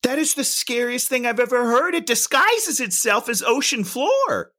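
high-pitched, appalled outburst; alarmed disbelief. Real, not acted. Reacting to poisonous rockfish hiding on the seabed, comic alarm. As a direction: push it up high, horrified and incredulous.

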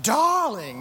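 a touch of a grand designer drawl on "Darling"; preacher briefly mimicking Edna Mode. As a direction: lightly voice the diva designer. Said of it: "she kinda says".

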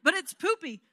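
a mock whine from one daughter; whiny kid complaint. Daughter objecting to the poopy diaper, whining. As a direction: play the sister who finds it gross.

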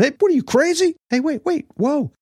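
very high-pitched squealed panic turning to joy; hammed-up falsetto yelp of a startled man; mock yelling. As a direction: squeal in mock alarm, then cheer. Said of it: "the guy's probably yelling".

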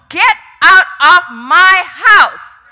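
slow, loud shouted command; retold scream. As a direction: drive out the devil, word by word. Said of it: "I screamed at him".

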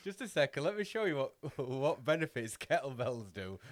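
high, loud showing-off voice; mock teacher demo. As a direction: play a proud gym teacher to the kids. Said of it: "and just go".

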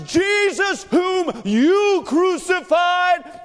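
high, loud, slowed preacher shout; fiery preaching. Retold or imitated. Peter preaching at the council, fiery shout. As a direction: thunder it from the soapbox. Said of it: "he starts preaching to the council".

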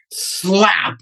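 high, drawn-out mock slap sound; imitated slap. Girlfriend's answer to a tactless remark, imitated slap.

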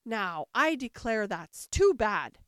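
loud, slow outburst of indignation; Humpty bursts out in a sudden passion. A narrator's reading. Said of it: "breaking into a sudden passion".

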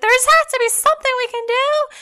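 high-pitched protest; imitated pleading. Neighbours pleading with animal control, imitated pleading.

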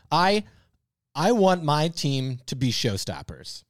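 ordinary talk

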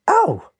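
very high yelp of pain; sudden squealed "ow"; pained cry. A narrator's reading. Lynx hit on the head by a ball, pained cry. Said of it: "He cried".

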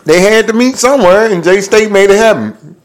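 proud hyped-up brag; excited boast. Real, not acted. Excited boast about Jackson State.

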